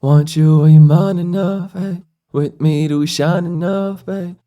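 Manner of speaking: usual speaking voice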